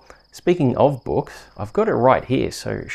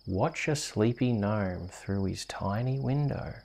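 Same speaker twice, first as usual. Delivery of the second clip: bedtime story reading. Acted. Host reading a bedtime rhyme to a sleepy child. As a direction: lull a little one to sleep.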